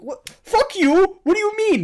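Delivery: high, loud outraged shout; indignant shriek at the rude customer; retold outraged yell. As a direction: yell it at him in disbelief.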